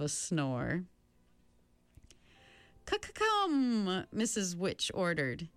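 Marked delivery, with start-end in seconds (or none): 2.9-4.1 high, stuttered, drawn-out order; quavering command. A narrator's reading. Mrs Which ordering the travelers onward, quavering command. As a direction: stutter the start and stretch the word out.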